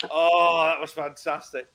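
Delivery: normal talking voice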